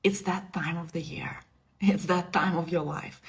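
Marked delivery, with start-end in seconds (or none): 0.0-1.4 unvoiced, breathy whisper of the goddess. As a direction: lean in and whisper it close. Kali's voice continues, whispered.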